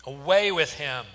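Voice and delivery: loud, punched crowd cry; yelling. A narrator's reading. The crowd rejecting their king, yelling. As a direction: yell it with weight on AWAY. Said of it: "they yelled".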